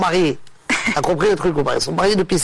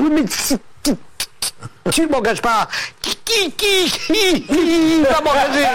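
higher, stuck on each word; stammering plea; 6 semitones higher than usual. Acted. Stuttering man begging the boss to hire him, stammering. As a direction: stutter out a pleading question. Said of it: "C'est un mec qui bégaye".

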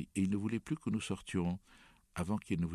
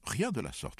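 higher-pitched startled protest; flustered, emphatic denial; 6 semitones higher than usual. A narrator's reading. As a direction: object at once, taken aback. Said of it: "protestai-je".

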